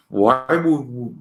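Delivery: usual speaking voice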